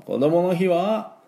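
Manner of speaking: ordinary talk, nothing marked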